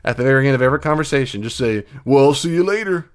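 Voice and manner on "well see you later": high, loud comic sign-off; playful put-on farewell; silly voice. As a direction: sing out a goofy goodbye. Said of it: "I'm being silly".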